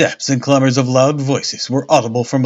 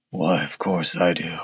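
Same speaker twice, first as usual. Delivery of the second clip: lower, wheezy stout inspector; wheezed; 7 semitones lower, 4 dB quieter than usual. Acted. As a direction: push it out with a wheeze. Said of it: "he wheezed".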